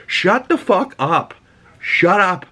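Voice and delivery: slow, high-pitched angry dismissal; exasperated. Real, not acted. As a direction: shut the critics down, drawing the words out. Snapping back at the fans.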